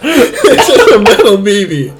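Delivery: can barely get the story out for laughing; giggling confession, words slowed by laughs; laughing through it. Real, not acted. Laughing while telling prank story.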